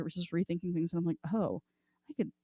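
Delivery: ordinary talk, nothing marked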